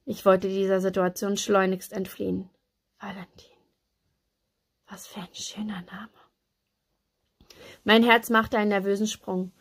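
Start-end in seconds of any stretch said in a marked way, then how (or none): 3.0-6.1 very soft whisper, to oneself; hushed inner thought. A narrator's reading. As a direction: savour it privately under your breath. Savouring the name inwardly.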